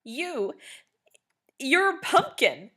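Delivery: high, loud cry; laughing hysterically. A narrator's reading. Blue mocking the pumpkin costume, high loud laughing cry. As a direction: burst it out, half laughing. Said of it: "cried in between hysterical fits of laughter".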